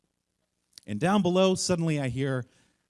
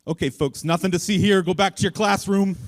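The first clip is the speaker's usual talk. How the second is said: raised voice, louder and higher as it goes; crowd control; 6 semitones higher, 7 dB louder than usual. Retold or imitated. Friends shooing sixth graders back to class, calling out. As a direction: call out to move a crowd along.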